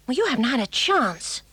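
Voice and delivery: ordinary talk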